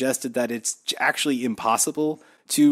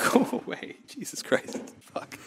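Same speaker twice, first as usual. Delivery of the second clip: laughing whisper; 9 semitones higher, 8 dB quieter than usual. Real, not acted. Laughing fit, whispered curse.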